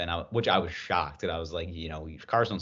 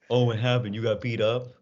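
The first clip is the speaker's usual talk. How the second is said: higher, louder sneering taunt; mocking dad; 4 semitones higher, 4 dB louder than usual. Tough dad taunting his son, mock sneer. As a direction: tease a weak kid like a tough dad. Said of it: "Maybe start with".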